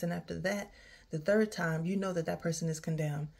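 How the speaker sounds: normal talking voice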